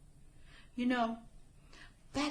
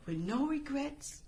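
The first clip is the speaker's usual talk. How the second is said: ordinary delivery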